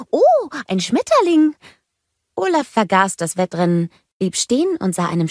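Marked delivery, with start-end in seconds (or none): 0.1-1.5 high, wondering exclamation; delighted surprise. A narrator's reading. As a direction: get suddenly distracted by something lovely. Olaf plötzlich entzückt abgelenkt. (entzückt, mit hoher Stimme)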